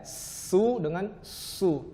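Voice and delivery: "su" with a light sin, then "su" with a heavy emphatic shad; slow demo of thin and thick s; sin versus shad. Shown as a demonstration. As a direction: contrast a thin "su" against a thick, throaty "su". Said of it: "Beda ya".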